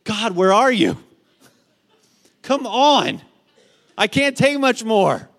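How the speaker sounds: high and loud, pleading desperation; desperate cry to God. Retold or imitated. Parent pleading with God at bedtime, loud desperate plea. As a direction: beg God like you're at the end of your rope. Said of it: "you're thinking".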